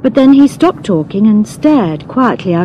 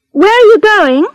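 raised, higher voice through a car window; calling out; 12 semitones higher, 5 dB louder than usual. A narrator's reading. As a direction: call the question out to someone at the roadside.

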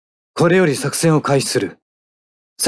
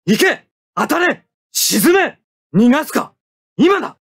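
high, forceful attack shouts; battle cries; 14 semitones higher than usual. Acted. Pilot attacking the enemy mobile suit, high forceful shouts. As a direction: yell them as each shot goes out.

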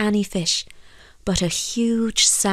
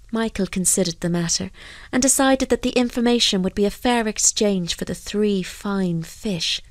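usual speaking voice

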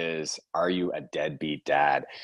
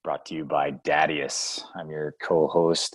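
ordinary talk, nothing marked

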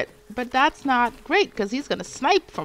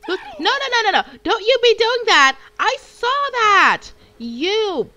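playful scolding; 9 semitones higher, 6 dB louder than usual. Real, not acted. Scolding a sneaky enemy in game.

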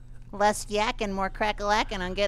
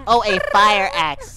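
ordinary talk

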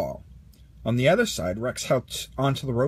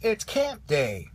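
put-on higher, slow, eager boy's voice; excited exclaim; 7 semitones higher, slower than usual. A narrator's reading. Boy waking up on camp day, excited exclaim. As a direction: draw it out with morning excitement. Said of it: "Andy exclaimed".

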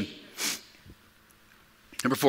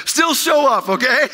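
high, loud and laughing; laughing plea; 9 semitones higher, 5 dB louder than usual. Real, not acted. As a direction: get it out between laughs. Jokes through his own laughter.

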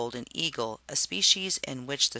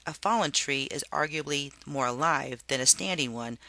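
usual speaking voice

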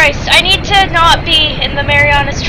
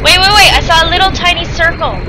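ordinary talk

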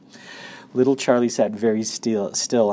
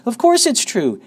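high, loud insistence; crying out; 14 semitones higher, 7 dB louder than usual. A narrator's reading. Old people insisting the tale is true, loud cry. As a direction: insist loudly, voice lifted high. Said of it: "cried all four of the old people at once".

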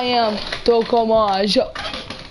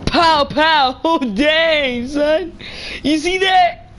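high, punchy gleeful outburst; excited hype; 5 semitones higher, much slower than usual. Real, not acted. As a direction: celebrate a hit, pitched up and laughing. Celebrates shooting in game.

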